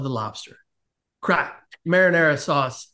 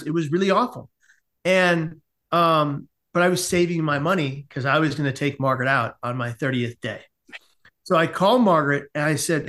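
usual speaking voice

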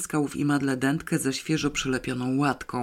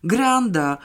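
louder, higher cry of outrage; yelling; 6 semitones higher, 5 dB louder than usual. A narrator's reading. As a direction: yell it out as a protest. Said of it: "darł się ktoś inny". (wydzieranie się z oburzeniem)

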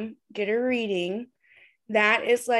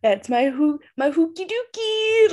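silly pet name; 6 semitones higher, 5 dB louder than usual. Acted.

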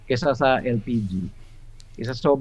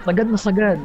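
laughing; 8 semitones higher, 4 dB louder than usual. Real, not acted. Agreeing, laughing at tight budget.

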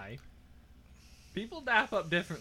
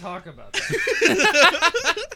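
loud; 9 semitones higher, 9 dB louder, much slower than usual. Real, not acted. Cutting off girlfriend joke.

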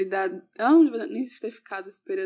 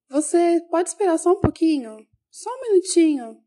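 put-on higher, coaxing voice talking to a little child; a gentle teacher asking a small girl to wait, higher and coaxing; sweet teacher to toddler; 6 semitones higher, 5 dB louder than usual. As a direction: ask the child nicely to hold on a minute.

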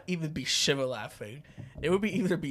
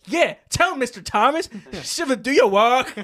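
higher, louder teasing order; mock teasing; 6 semitones higher, 7 dB louder than usual. Che teasing Shiva in class, loud mocking order. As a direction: egg the teacher on. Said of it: "Che would be like".

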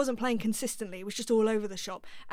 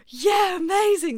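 high, loud gush of delight; thrilled; 9 semitones higher, 12 dB louder, slower than usual. Retold or imitated. Recalling the producer's call, a high loud excited gush. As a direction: squeal it out like a dream come true. Thrilled reply to phone offer.

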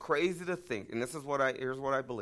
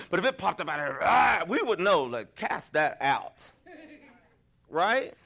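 excited demon alarm; 5 semitones higher, 4 dB louder than usual. Real, not acted. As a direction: rise up alarmed, then drag out the command. Mock demon-casting moment for congregation.

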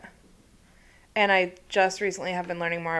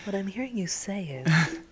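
therapist voice. Pretend. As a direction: reflect it back like a therapist. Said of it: "I also really enjoy your therapist voice".

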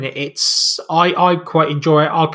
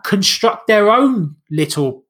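ordinary talk

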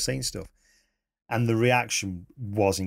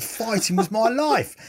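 laughing through it, much higher and louder; 12 semitones higher, 4 dB louder than usual. Retold or imitated. Old Para recalling his fighting days, laughing. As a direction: laugh out the punchline. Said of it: "He said".

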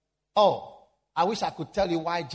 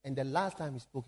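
usual speaking voice

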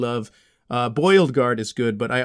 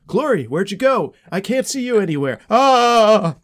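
higher, louder, searching calls; mock confusion; 8 semitones higher, 6 dB louder than usual. Clay searches for the vanished Glory, mock alarmed. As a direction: call out for someone you can't see. Can't find the invisible Glory.

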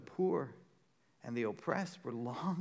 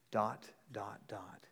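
whisper; 5 semitones lower, 6 dB quieter, slower than usual. Acted. Elliptical aside in Jesus quote.

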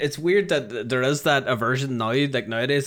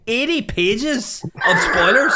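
laughing; 10 semitones higher, 5 dB louder, slower than usual. Real, not acted. Laughing at magazine spoilers.

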